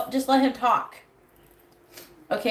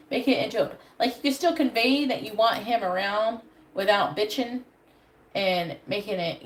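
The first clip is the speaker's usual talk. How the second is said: ordinary talk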